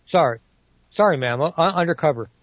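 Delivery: ordinary delivery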